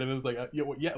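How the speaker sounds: ordinary talk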